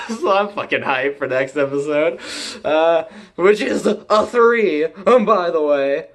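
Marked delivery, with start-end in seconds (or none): none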